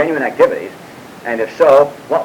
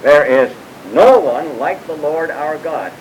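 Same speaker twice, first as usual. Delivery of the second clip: no stretched out, each word weighed; slow and solemn, lifted high; slow emphatic reading; 4 semitones higher, 4 dB louder, slower than usual. Shown as a demonstration. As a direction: read it slowly, leaning on no.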